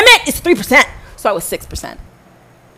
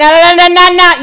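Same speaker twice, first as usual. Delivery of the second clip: loud, pitched-up run of no; retold sergeant being cut off with loud no's; loud cutting off no's; 6 semitones higher, 7 dB louder than usual. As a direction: shut the interruption down hard.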